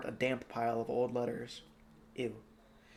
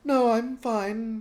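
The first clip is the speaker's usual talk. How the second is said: slow, higher reassurance; assuring; 10 semitones higher, 8 dB louder, much slower than usual. Acted. Charmaine reassuring the king, slow and higher. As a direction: reassure him gently. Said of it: "Charmaine assured him".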